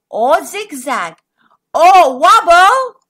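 slow, swelling to loud; storyteller emphasis; dramatic build. A narrator's reading. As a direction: stretch each word out.

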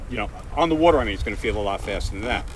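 ordinary delivery

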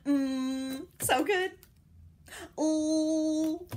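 usual speaking voice

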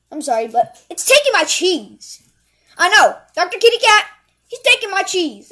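loud, dramatic cry for help; urgent loud plea. Acted. Caller begging Doctor Kitty Cat to catch the mouse, loud and distraught. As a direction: wail the complaint down the phone, loudly. Phone plea about cheese thief.

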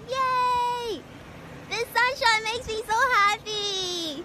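high, loud happy cheer; excited cheer. Real, not acted. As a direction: burst out with sunny joy. Cheering about the sunshine.

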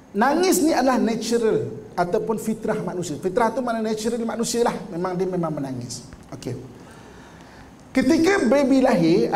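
normal talking voice

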